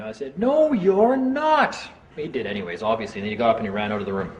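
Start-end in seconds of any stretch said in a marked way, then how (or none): 0.4-1.8 high, drawn-out yell. Retold or imitated. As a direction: stretch the no into a high defiant yell. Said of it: "And I'm just yelling at him".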